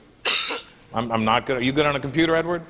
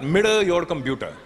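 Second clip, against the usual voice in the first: put-on gloating Indian accent; 5 semitones higher than usual. Agent taunts the comedian for his jokes in a gloating Indian accent. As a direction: relish the revenge in an Indian accent.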